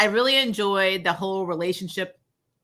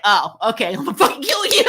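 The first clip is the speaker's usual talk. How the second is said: mock rage; 6 dB louder than usual. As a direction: play her sudden fury half-laughing. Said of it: "And it was like".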